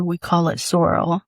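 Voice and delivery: ordinary delivery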